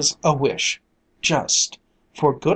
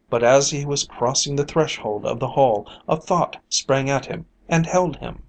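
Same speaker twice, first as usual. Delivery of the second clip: ordinary delivery, nothing marked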